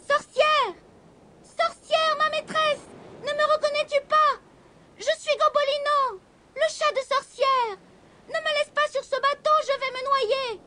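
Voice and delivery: high, small kitten voice calling for help; a frightened little cat shouting from the mast; kitten crying out. A narrator's reading. The kitten calls desperately to the witch, high-pitched. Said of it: "l'entendirent crier".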